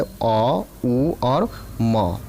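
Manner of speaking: अ, उ और म drawn out very slowly, one sound at a time. Shown as a demonstration. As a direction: stretch each part of ओम, अ then उ then म, slowly. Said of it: "जैसे ओम, तो ओम में आपका तीन मात्रा का उच्चारण होता है".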